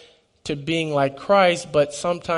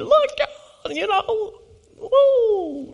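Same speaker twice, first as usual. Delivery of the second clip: smug showoff; much slower than usual. Retold or imitated. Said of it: "I'm on my spiritual high horse".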